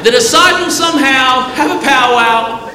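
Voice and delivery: high, excited sermon storytelling; animated preaching. Acted. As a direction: preach the tale with high energy, acting out the squabble. Animated preached retelling of the storm.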